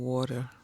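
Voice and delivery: "water" with the T softened away, American style; Americanized pronunciation. Retold or imitated. Catching herself switching for Americans, "water" said the American way. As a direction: imitate an American saying "water", T dropped. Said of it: "What happened to the tea?".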